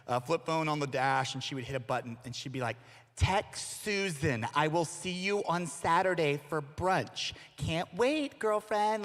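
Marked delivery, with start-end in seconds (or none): 3.2-7.3 slow, deliberate dictation voice; mom dictating a text. Retold or imitated. Mom speaking to text on a flip phone, slow dictation. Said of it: "just speak to text". 7.6-9.0 higher, chummy excited mom; mom's mock excitement. Mom dictating to her friend Susan, mock excited. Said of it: "really excited to see your friend".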